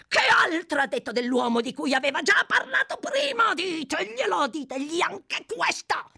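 high, insistent crying out; frantic shouting. A narrator's reading. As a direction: keep the demand urgent and high to the end.

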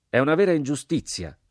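high, loud outraged cry; the sergeant bursts out, beating his chest; shouting. A narrator's reading. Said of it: "gridò il sergente".

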